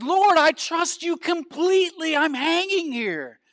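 high, loud desperate shout; frantic pleading. Acted. Hanging man assuring the Lord of his trust, frantic pleading. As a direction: plead at full voice, stretching the last words.

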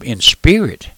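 ordinary talk

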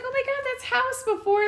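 high-pitched retold realisation; excited recognition. Retells recognising actor.

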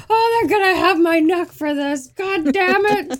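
high, loud stressed police chief; gruff barking police captain. Retold or imitated. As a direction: bellow like a cornered boss. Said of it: "stereotypical police chief boss kind of thing".

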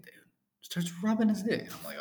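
usual speaking voice

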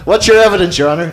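loud, high-pitched playful challenge; mock courtroom questioning. Host playing out a mock trial of Darren's evidence, mock courtroom questioning. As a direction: put the question grandly and loudly, amused.

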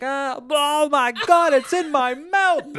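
high, loud, drawn-out mock shriek; imitating a screaming sister; retold panicked scream. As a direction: shriek it in hammed-up horror. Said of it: "she screamed, and she was like".

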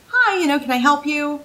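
chirpy greeting to a customer; customer service voice. Shown as a demonstration. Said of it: "my customer service voice sits up here".

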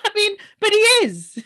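high, loud words through real laughter; laughing agreement. Real, not acted. Podcaster agreeing with co-host's quote, laughing hard. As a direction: laugh while insisting on it.

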